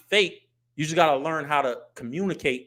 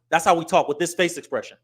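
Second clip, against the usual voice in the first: ordinary delivery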